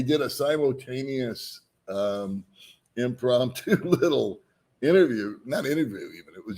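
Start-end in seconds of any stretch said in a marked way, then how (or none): none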